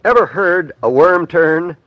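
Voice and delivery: ordinary talk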